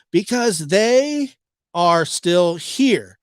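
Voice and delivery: slow, loud deliberate quote; slow, loud, paused. Retold or imitated. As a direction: drag it out with a pause. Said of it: "there's a pause".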